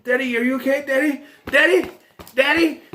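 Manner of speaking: high-pitched mock-anxious calling; worried daughter. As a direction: imitate a daughter calling to rouse her dad. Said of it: "This is what she does. She goes".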